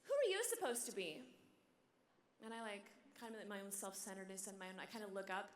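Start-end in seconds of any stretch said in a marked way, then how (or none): 0.0-1.2 put-on high-pitched little girl's question; high child voice, falling at the end. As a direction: do a small child demanding an answer, pitched up. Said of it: "this voice says".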